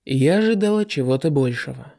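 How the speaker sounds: plain reading voice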